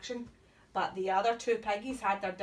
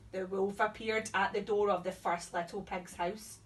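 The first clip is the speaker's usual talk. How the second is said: ordinary delivery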